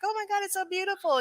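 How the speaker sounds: high, gushing mock awe. Gushing over pictures of Hawaii, high mock awe. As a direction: swoon over the vacation photos. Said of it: "you're like".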